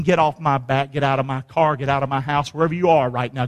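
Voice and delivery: low-pitched, controlled dismissal; understated rebuke. Acted. Preacher telling Satan to leave, low controlled rebuke. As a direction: keep it low while ordering him out.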